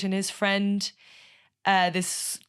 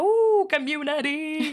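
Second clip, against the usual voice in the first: slow, high, drawn-out ooh of delight; mock-dreamy community; playful delight; 8 semitones higher, 3 dB louder, slower than usual. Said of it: "I was really like".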